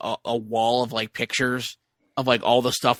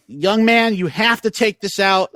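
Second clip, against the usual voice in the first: imitating a stern scolding officer, higher and louder, slow; TSA lady scolding; 7 semitones higher, 4 dB louder, slower than usual. TSA lady scolding an eight-year-old boy, mock stern and loud. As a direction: play the TSA lady chewing out a kid. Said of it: "starts, like, chewing him out".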